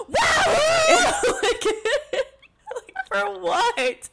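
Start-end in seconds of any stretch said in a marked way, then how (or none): 0.1-1.2 very high mock "wow" shriek; screamed-out parody cheer; imitated scream. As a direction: imitate a wild, over-committed cheer. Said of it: "then fucking Sam is like".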